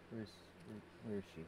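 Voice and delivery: ordinary talk, nothing marked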